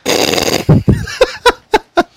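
high, loud helpless laugh; loud burst of laughter. Real, not acted. Laughs at kitten joke.

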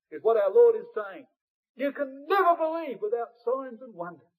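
normal talking voice

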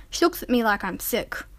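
usual speaking voice

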